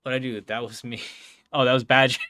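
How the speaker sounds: normal talking voice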